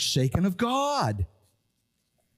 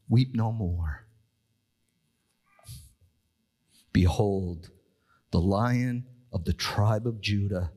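lower, grave Bible reading; solemn scripture reading; 7 semitones lower than usual. Acted. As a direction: read it low and unhurried. Elder's words read solemnly.

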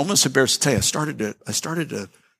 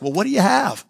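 laughing quip; 7 semitones higher than usual. Retold or imitated. Joking reply to waiter, laughing.

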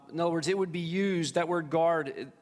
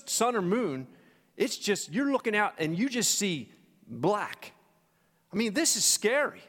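rising sermon intensity; 6 semitones higher than usual. Acted. Pastor telling the storm story to his congregation, building intensity. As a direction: paint the storm and make them feel it.

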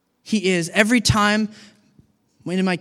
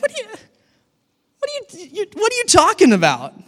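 high, incredulous stammer; Jesus lost for words, rising sharply; a mock-bewildered Jesus, pitched way up; baffled; 14 semitones higher, 4 dB louder, faster than usual.

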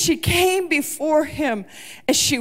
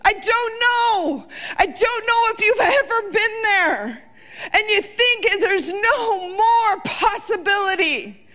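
impassioned preaching; 7 semitones higher than usual. Acted. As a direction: preach it out, climbing toward the peak. Preacher holds full force.

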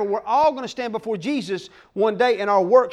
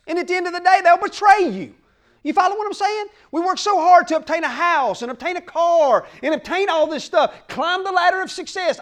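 raised, higher preaching voice building in force; fiery preaching; 6 semitones higher, 3 dB louder than usual. Real, not acted. Pastor warns the congregation about chasing worldly success, fiery preaching. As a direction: preach it hard to the congregation, pressing the point.

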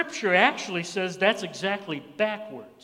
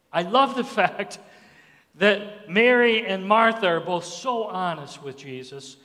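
ordinary talk

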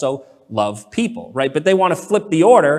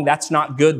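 ordinary delivery

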